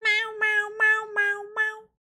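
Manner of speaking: high mock cat meows. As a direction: meow like a playful cat. Streamer meowing along to song.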